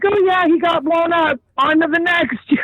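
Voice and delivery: breezy brush-off, pitched high and amused; imitating someone shrugging off bad news; mock nonchalant. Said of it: "it was kinda nonchalant".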